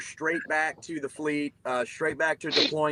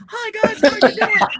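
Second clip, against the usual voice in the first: high-pitched mock chaplain, naively cheery; doe-eyed false hope; 9 dB louder than usual. Mocking the chaplains' greeting to the troops, doe-eyed false hope. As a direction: put on a loud, high, dopey greeting. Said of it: "dumb P F C doe-eyed false hope".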